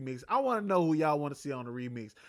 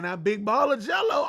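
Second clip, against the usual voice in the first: hyped shout; 4 semitones higher, 6 dB louder than usual. Real, not acted. Hyped confirmation, loud.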